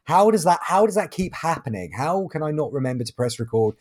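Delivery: ordinary talk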